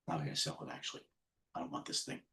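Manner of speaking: muttered aside. Real, not acted. Muttering to himself about selling.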